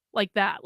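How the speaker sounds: long exhausted sigh, all energy gone; drained sigh. Retold or imitated. Woman slumping in her hotel room after a scare, drained sigh. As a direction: let the air out like you're empty. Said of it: "my whole body's like".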